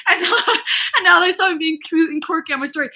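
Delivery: high, loud, laughing through the words; real embarrassed laugh spilling into speech; laughing with embarrassment. Real, not acted. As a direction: laugh at your own embarrassment. Embarrassed laugh at own oversharing.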